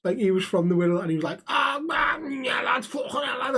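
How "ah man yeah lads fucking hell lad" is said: high-pitched, put-on Scouse accent; overdone Scouse lad, pitched up; mock pure Scouse. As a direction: imitate a kid trying too hard to sound Scouse. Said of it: "trying to be pure Scouse".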